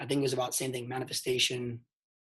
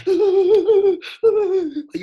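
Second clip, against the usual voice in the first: mock sobbing; 12 dB louder than usual. Shown as a demonstration. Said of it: "you can't breathe".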